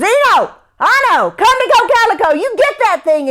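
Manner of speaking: high, loud, fast urgent call; calling the dogs. Acted. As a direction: shout to the dogs outside. Jake yells to dogs.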